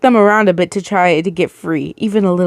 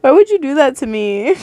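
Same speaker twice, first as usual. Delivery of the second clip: higher, near-tearful complaint; emotional reaction; 7 semitones higher than usual. Real, not acted. Reader upset over the kid, near-tearful. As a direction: plead as if about to cry. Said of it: "I'm an emotional person".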